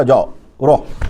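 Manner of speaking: low, slow, offhand wave-through from a guard. Retold or imitated. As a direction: let them pass with a careless low mutter. Policeman waves them through. (Arab police والے کا دھیما، سست انداز)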